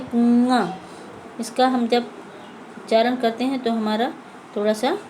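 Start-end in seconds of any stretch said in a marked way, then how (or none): 0.1-0.7 कुआं with the final आं through the nose; nasal vowel demo. Shown as a demonstration. Teacher showing pupils the chandrabindu sound in कुआं. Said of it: "हमारे नाक से आवाज़ निकलती है".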